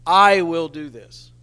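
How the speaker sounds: "I" hit very loud, the rest easing off; forceful, loud stress on "I"; emphatic I. Shown as a demonstration. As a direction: punch the word "I" hard. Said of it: "is emphatic".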